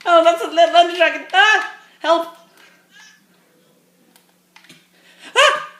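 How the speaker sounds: high panicked shrieking pleas; terrified gibbering. Real, not acted. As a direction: beg it to stay away, screaming. Fleeing the Ender Dragon.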